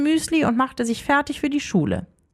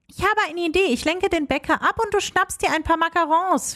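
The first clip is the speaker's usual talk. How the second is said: ordinary delivery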